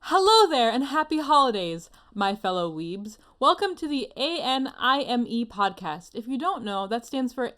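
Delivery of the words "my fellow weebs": normal talking voice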